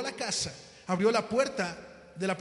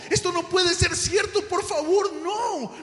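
high, loud anguished pleading; desperate; 8 semitones higher, 7 dB louder, slower than usual. A narrator's reading. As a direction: wail it, begging for your child. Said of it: "José desesperado le decía". (súplica desesperada, aguda y fuerte)

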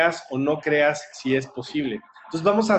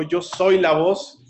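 higher, slow proclamation; imitated forceful cry; 5 semitones higher, slower than usual. Retelling the girl's turning point, forceful imitated cry. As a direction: declare it strongly, stretching the words.

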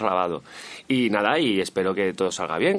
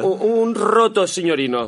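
put-on higher-pitched playful Italian accent; mock Italian accent; 8 semitones higher, 5 dB louder than usual. Bar owner adds to the invented menu, mock Italian accent. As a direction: keep up the comic Italian voice. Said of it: "que es como se hace el acento italiano".

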